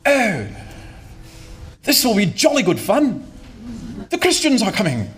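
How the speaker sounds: put-on plums in the mouth posh English accent. Posh English chairman mocking the Christians, plummy accent. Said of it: "forgive me doing the accent".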